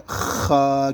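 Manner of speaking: خا with a long rasping kh, vowel held level; throat-clearing خا. Shown as a demonstration. Teacher demonstrating the letter, خا rasped from the throat. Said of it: "کھکارنے کی جس طریقے پر آواز".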